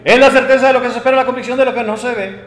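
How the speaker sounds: rapid-fire memorized answer, starting loud and high; racing rote recitation. Acted. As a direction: rattle it off from memory without thinking. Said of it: "inmediatamente la mente corre". (recitado de memoria a toda velocidad)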